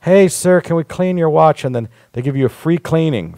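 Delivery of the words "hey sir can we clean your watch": high, loud salesman call to passers-by; pushy kiosk seller. Retold or imitated. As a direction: hail a guy with a nice watch, much higher and louder. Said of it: "And they go".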